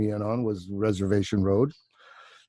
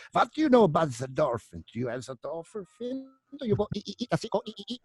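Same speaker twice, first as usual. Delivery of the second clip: high, manic put-on foreign accent; Jim Carrey; 9 semitones higher, faster than usual. Actor imitating his co-star questioning a reporter, high crazed voice. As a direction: squeal it like a crazed dolphin expert, ending in ee-ee chatter. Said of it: "And Jim comes out".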